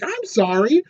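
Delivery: high, loud indignant protest; defensive Robot Man. Retold or imitated. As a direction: whine back that you're the one who saw it. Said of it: "And he's like".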